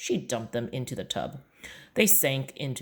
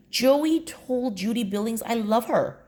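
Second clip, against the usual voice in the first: put-on high boy's voice, blurting a confession; child's voice; 9 semitones higher, 3 dB louder than usual. Boy confessing to his mom in the bath, high embarrassed child's voice. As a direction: squeak it out like an embarrassed kid.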